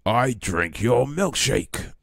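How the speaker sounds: Daniel Plainview. Acted. Streamer quoting There Will Be Blood, slow Plainview impression. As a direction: stretch each word theatrically, swooping up. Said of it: "Daniel Plainview".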